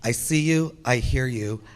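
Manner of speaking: normal talking voice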